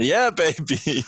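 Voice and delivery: put-on slow, drawn-out smooth-talker yeah baby; cheesy yeah baby. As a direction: lay it on like a cheesy lounge lizard. Cheesy reply to a joke.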